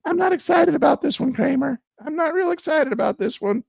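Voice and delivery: high, squeaky mock of his own reply; mocking himself. Retelling his answer to Kramer in a high mocking squeak. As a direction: pitch way up, play it as a silly whining retort. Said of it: "And I was like".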